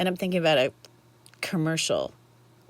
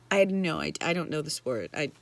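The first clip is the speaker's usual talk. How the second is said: ordinary talk, nothing marked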